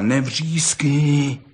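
put-on slow, drawn-out boyish reproof; mildly scolding pal; admonishing. A narrator's reading. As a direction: stretch it out to hush a howling friend. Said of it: "domlouval Pepíkovi".

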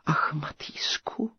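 breathy whispered sigh, tearful. A narrator's reading. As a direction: sigh his name in despair. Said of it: "vzdychla knížecí dcera". (povzdech šeptem)

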